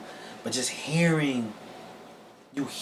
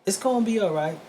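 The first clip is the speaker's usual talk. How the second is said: higher, louder voicing of the lady's comfort; a lightly voiced kind stranger; 8 semitones higher, 4 dB louder, faster than usual. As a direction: play the stranger soothing a mourner. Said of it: "And she says".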